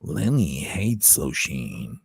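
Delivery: low, very slow monster growl; Lenny monster voice. Shown as a demonstration. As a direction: drag it out like a lumbering monster. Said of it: "Lenny is a different guy".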